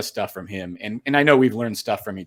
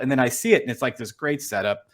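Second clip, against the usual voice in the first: normal talking voice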